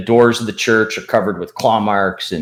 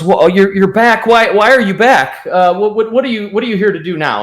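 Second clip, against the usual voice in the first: high, loud, stammering alarm; acted flustered unease, tripping over repeated words; nervous, apprehensive; 9 semitones higher, 4 dB louder than usual. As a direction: stammer it out, pitched up and rattled. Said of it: "he looks really nervous and apprehensive".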